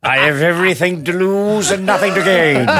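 put-on high, laughing fussy old-man voice; Jonathan Harris impression. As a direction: sniff it with grand disdain. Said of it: "Jonathan Harris quote".

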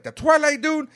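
high-pitched disbelief, imitating everyone; incredulous reaction. Everyone reacting to the Batman casting news, incredulous. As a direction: blurt it like a stunned fan.

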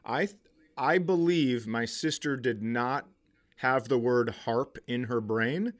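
ordinary talk

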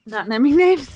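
teasing with a laugh. Real, not acted. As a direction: tease it through a chuckle. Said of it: "teasing aside, laughing".

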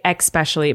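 "especially" said as ex-specially; X mispronunciation. Retold or imitated. Reporting what people say, X mispronunciation. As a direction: put a K sound into "especially". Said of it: "especially is not a word".

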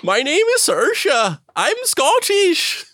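very high, slow mock Scottish voice; hammed-up Scottish accent. Introducing herself as Saoirse, hammed-up Scottish accent. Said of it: "it was a Scottish accent".

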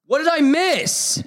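drawn-out, high-pitched cry of frustration; exasperated wail. Real, not acted. Reciter told he skipped a president, high exasperated wail. Told his list is wrong.

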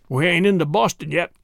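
ordinary delivery, nothing marked

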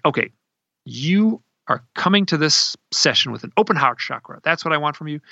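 retold stern order, words set apart; stern, tough. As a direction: imitate someone firmly laying down terms. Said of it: "stern. She was tough".

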